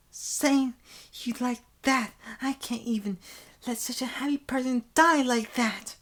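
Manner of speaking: put-on high, distressed girlish wail; weeping protest. Senpai weeping over a happy person dying, high protest. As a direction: cry out in a high, pleading voice. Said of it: "continues to weep".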